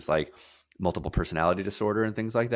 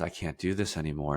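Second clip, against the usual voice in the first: defeated body voice; 5 semitones lower, 7 dB quieter, faster than usual. Retold or imitated. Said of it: "my body says".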